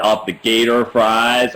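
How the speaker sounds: put-on slow, lightly done American accent on "gator fries". Imitating the American dad ordering gator fries, American accent. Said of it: "I'd sound really British next to him".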